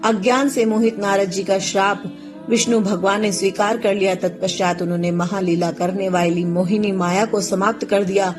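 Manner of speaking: usual speaking voice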